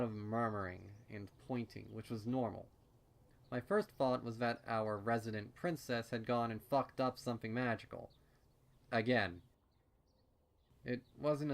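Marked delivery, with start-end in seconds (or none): none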